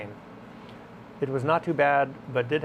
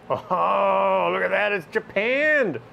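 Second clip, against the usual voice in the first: louder, higher, drawn-out delighted "oh"; excited discovery; 6 semitones higher, 5 dB louder, much slower than usual. Real, not acted. Man checking his plane's lever cap, excited discovery. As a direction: exclaim with real delight at the find.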